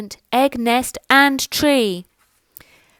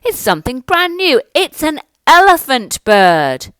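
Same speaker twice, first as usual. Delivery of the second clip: very high, loud cry of wonder; loud, high, awed crowd; amazed shout; 6 semitones higher, 8 dB louder than usual. Acted. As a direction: marvel at the elephant bird. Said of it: "they shouted".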